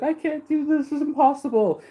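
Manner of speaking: high, wailing mock despair; imitating crying maiden. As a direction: play the locked-up maiden, hopeless. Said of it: "she's crying, and she's like".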